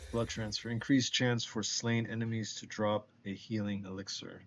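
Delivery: ordinary talk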